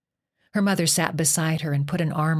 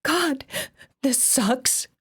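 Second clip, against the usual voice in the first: high, tearful complaint; 8 semitones higher, much slower than usual. Acted. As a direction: whine it through tears. Exhausted girl crying on climb.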